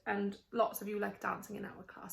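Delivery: soft, fast aside to the class; quiet aside. Real, not acted. Teacher chatting softly to her class between readings. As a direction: murmur quickly to the pupils.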